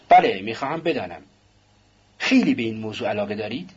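ordinary delivery

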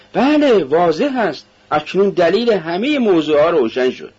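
excited, high-pitched burst of understanding; sudden realisation. Acted. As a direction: exclaim it as everything suddenly becomes clear. Friend suddenly understands, acted dialogue.

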